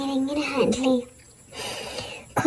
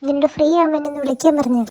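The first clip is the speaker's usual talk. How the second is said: ordinary talk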